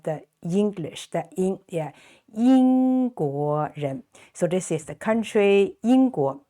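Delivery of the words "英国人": 英国人 said slowly, tones clear; slow model Mandarin. Shown as a demonstration. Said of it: "teacher models the word".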